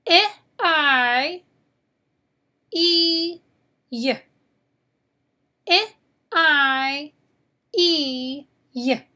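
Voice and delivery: "I, I, E, Y" as ih, eye, ee, yuh, each isolated; short ih, held eye and ee, short yuh for "I, I, E, Y"; four letter sounds. Shown as a demonstration. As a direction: drill "I, I, E, Y" with pauses between sounds. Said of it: "Can you say those four sounds?".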